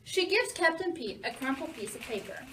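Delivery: ordinary delivery, nothing marked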